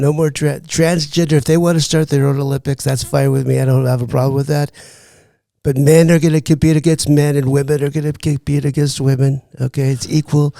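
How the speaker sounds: impersonated politician holding forth; Donald Trump. Acted. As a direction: play the president on a comedy show.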